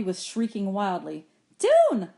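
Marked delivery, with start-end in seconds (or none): 1.6-2.1 high, loud shout of a name. A narrator's reading. Girl calling to her companion in a rushing boat, shouting. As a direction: call out to a companion over the din. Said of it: "Lina shouted".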